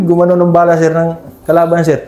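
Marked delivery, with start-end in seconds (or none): none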